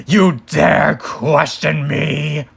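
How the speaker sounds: slow, loud evil overlord; menacing villain. Acted. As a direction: lean into the threat slowly. Dark lord rebukes servant.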